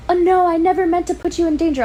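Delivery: high, loud hammed-up apology; mock damsel, loud and high; mock Bella. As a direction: overplay her breathless guilt. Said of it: "she's like".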